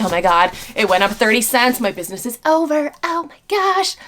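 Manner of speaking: imitating people freaking out, rising to a high squeal; mock-dramatic oh my God, pitch shooting up at the end; mock panic. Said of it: "They're like".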